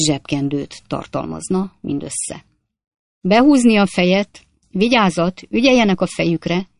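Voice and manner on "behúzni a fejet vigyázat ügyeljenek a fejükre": higher, louder calls to duck; shouted warning. Acted. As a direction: bark the warning up at the passengers. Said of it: "kiáltotta a bőbeszédű idegen".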